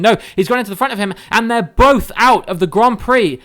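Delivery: sudden loud, high shout of surprise; excited commentary. Real, not acted. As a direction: call the crash at full volume. Crash caught live in commentary.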